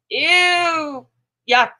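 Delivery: high, loud squeal of disgust. Real, not acted. Reacting to a gross picture, disgusted squeal. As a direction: recoil from the sight with a shrill ew.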